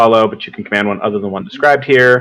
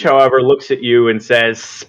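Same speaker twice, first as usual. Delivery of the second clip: usual speaking voice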